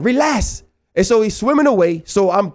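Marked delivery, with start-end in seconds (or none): 0.0-0.6 mimicked call, a bit high; raised-pitch mock "relax"; imitated "relax" call. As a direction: mimic the guy who keeps brushing you off. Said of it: "he keeps saying".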